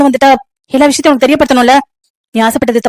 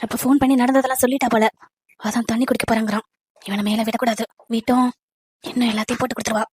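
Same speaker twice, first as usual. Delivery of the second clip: hushed, much softer and lower; scheming aside; 4 semitones lower, 12 dB quieter than usual. Acted. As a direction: mutter it to yourself so he cannot hear. Scheming aside, unheard by son. (தனக்குள் முணுமுணுப்பு)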